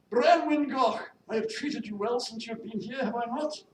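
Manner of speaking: loud, high, commanding lady employer; imperious mistress. Acted. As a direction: summon the maid with haughty authority. Mistress summons and confronts maid.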